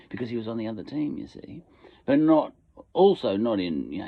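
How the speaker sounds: usual speaking voice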